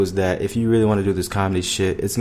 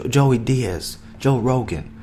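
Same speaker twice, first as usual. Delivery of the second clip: usual speaking voice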